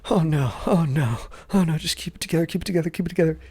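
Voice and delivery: rising panic, pleading with himself; panicked self-talk. Retold or imitated. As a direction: mutter oh no over and over, barely holding on. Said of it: "and I'm just like".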